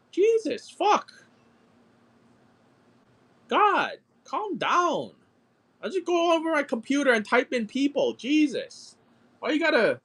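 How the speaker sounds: a put-upon eighth grader groaning back; exasperated whine. Retold or imitated. Teen griping about the teacher's correction, high exasperated whine. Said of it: "I was like".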